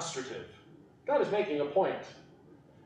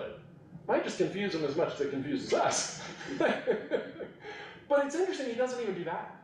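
ordinary talk, nothing marked

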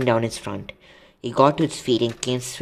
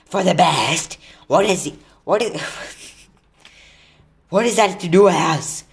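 loud, raised-pitch indignant rebuke; angry; 3 semitones higher, 5 dB louder than usual. A narrator's reading. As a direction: throw it back in outraged disbelief. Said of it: "kicked his back legs in har-, anger".

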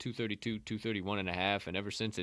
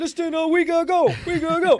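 mocking echo; 12 dB louder than usual. Mocking partner's words.

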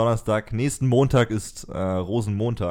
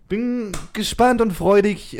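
excited; 12 semitones higher, 5 dB louder, slower than usual. Pretend. Excited podcast opener.

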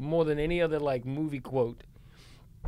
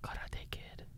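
whisper; 18 dB quieter, slower than usual. Real, not acted. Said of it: "whispered aside".

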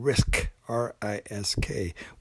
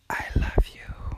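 whispered. Pretend. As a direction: breathe it as a secret. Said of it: "the second most uttered is this one".

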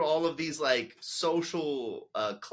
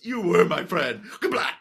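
dramatic reply; 5 semitones higher, 9 dB louder than usual. Retold or imitated. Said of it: "And he goes".

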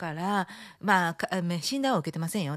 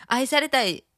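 laughing; 10 semitones higher, 4 dB louder, slower than usual. Real, not acted. Laughing sung fragment.

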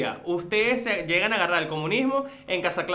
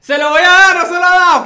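much higher and louder, sneering parody; mocking imitation; 11 semitones higher, 16 dB louder than usual. Teasing his cohost for never paying the oil, loud mocking parody. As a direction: parrot his empty promise to mock him.

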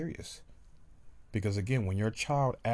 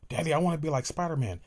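put-on higher, louder, fast excited kid; child voice; 7 semitones higher, 5 dB louder, faster than usual. Child telling daddy his wish, high and fast. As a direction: blurt it like a thrilled little boy.

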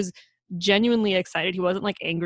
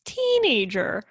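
much higher, indignant outburst; outraged protest; 8 semitones higher than usual. Real, not acted. Co-host outraged at the dad.

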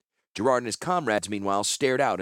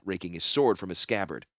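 usual speaking voice

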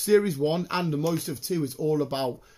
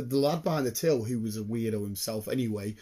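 usual speaking voice

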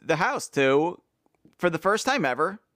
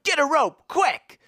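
high, urgent cry for help; urgent call; 9 semitones higher than usual. Acted. Boy hanging by rapids calling men, urgent cry. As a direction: call out a quick order.

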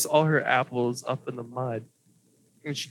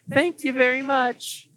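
higher, brighter chirpy thanks; elf girl thanks; 10 semitones higher, 3 dB louder than usual. Acted. Elf girl thanks the party as she walks off, high chirpy. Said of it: "and she's like".

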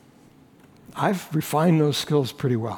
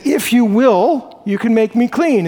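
bold plea; 4 semitones higher, 4 dB louder than usual. Acted. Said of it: "he came and said".

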